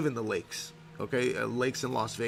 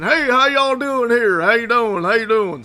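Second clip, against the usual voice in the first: put-on Texan accent; 9 semitones higher, 11 dB louder than usual. Imitating the suited Texan agent greeting the sellers, loud Texan accent. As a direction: ham up a loud Texan real estate agent. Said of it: "he had a Texan accent".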